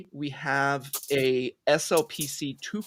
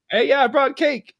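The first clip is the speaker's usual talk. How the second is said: breezy party announcement; 10 semitones higher, 6 dB louder than usual. Acted. Acts out presenting the prank cake.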